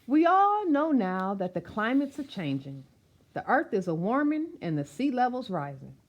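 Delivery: lively rhythmic read-aloud of a rhyming picture book; reading to children. Acted. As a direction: read it out warmly to a room of kids, bouncing the rhymes.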